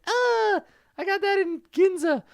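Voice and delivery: very high, comic whiny complaint; high squeaky henchman. Pretend. Henchman to Jackson, over his shredded jacket: high squeaky whine.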